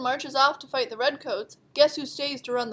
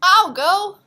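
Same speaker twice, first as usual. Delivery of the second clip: a louder, bold I'll go; loud eager offer; eager volunteer; 5 semitones higher, 9 dB louder than usual. Acted. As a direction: step up and offer boldly. Sybil volunteers to ride.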